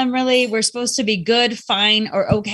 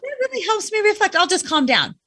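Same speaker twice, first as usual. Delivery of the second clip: mock gratitude; 8 semitones higher than usual. Upset person answers being told to calm down, sarcastically. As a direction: pretend the useless advice worked wonders. Mocking a reply nobody ever gives.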